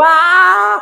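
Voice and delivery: high drawn-out "waah" yell; warm-up yell. Shown as a demonstration. Speaker shows how he warms up in his room, high yell. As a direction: open up with a long, high yell. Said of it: "saya teriak-teriak di kamar dulu".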